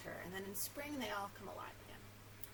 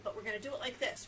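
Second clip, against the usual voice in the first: ordinary delivery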